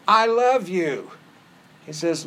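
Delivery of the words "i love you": mock gushing Peter. Peter voiced, mock sappy.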